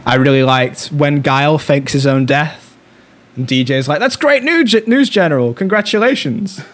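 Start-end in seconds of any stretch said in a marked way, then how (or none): 4.0-6.6 movie quote. Retold or imitated. Quoting movie line, high mock voice.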